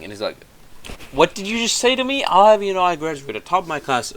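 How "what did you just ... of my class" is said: high, loud mock outrage; indignant outburst. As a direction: act out puffed-up, offended indignation. Retold Farquaad indignant at mirror.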